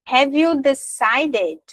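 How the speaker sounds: very slow, every word of "Have you decided?" clear; slow model sentence. Shown as a demonstration. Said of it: "praticar em voz alta".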